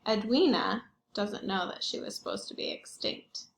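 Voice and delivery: ordinary delivery, nothing marked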